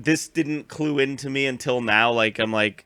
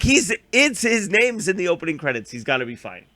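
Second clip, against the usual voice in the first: past self; 5 semitones higher than usual. Retold or imitated. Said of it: "So I'm like".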